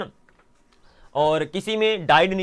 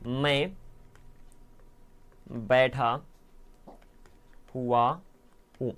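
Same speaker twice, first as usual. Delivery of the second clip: softer, each word set apart by long pauses; slow word by word; 5 semitones lower, 5 dB quieter, much slower than usual. Shown as a demonstration. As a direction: lay out the sentence slowly for the class. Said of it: "यहाँ पर ध्यान सुनो". (धीरे धीरे, हर शब्द अलग करके)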